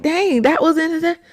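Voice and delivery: mock exasperation; exasperated surprise. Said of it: "I'm like".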